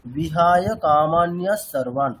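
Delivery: বিহায় কামান্যা সর্বান read slowly as one unit; slow example recitation. Shown as a demonstration. Teacher giving students an example line. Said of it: "আপনাকে একটা উদাহরণ দিচ্ছি".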